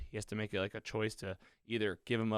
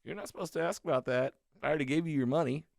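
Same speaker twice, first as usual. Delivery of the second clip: higher, quick imitated retort; the guy's retort; 6 semitones higher, faster than usual. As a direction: act out the client's brush-off in a raised voice. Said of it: "And the guy's like".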